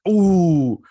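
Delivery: loud, high "ooh" sliding down; mad "ooh". Shown as a demonstration. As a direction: demonstrate your own angry "ooh". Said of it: "I do that when I get mad".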